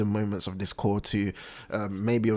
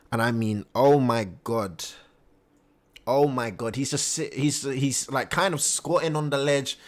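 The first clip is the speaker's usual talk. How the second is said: ordinary talk, nothing marked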